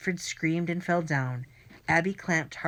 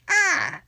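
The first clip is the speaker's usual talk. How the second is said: very high loud cry of pain; pained scream; 8 dB louder than usual. A narrator's reading. Villain hit on the other knee, screaming. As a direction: howl as the other knee is struck. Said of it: "he screamed".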